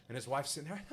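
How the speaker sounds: usual speaking voice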